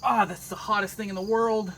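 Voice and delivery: high strained cry after a burn; pained yelp. Real, not acted. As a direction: yelp it out, hand just burned. Just burned hand on hot metal.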